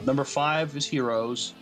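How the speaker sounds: normal talking voice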